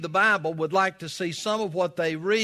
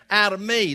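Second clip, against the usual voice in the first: Out of me, higher and louder; emphatic repeat; 5 semitones higher, 6 dB louder than usual. Acted. Pastor presses the point on his congregation, louder and higher. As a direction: drive Out of me home to the room. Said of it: "Did you get that part?".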